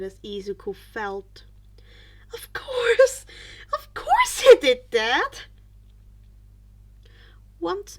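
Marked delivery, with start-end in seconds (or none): 2.3-5.4 exasperated dismay. Acted. Horrified realization, inner outburst.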